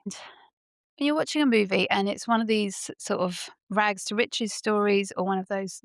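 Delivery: ordinary delivery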